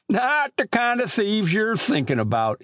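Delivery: put-on high-pitched rustic old man; old country storekeeper. Old storekeeper grumbling to boys about chicken thieves, high rustic voice.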